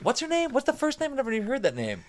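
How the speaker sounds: very high-pitched puzzled questioning; baffled. Retold or imitated. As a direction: act out drawing a total blank. Acts out not knowing her name.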